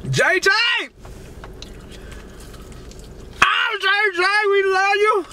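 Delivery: high shouted call of love. Real, not acted. Calling out to J J mid meal, high joyful shout. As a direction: shout it across the room with joy.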